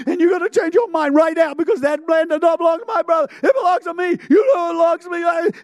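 high, loud nagging rant; relentless demanding. Acted. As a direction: hammer the demand without letting up. Acted woman ranting at judge.

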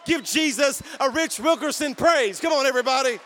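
loud, high preacher's call for praise; rally the crowd to shout praise; full-force preaching. Acted. Preacher firing up the congregation, loud and high.

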